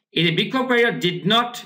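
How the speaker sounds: ordinary delivery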